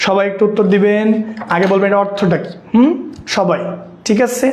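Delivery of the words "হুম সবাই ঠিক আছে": ordinary talk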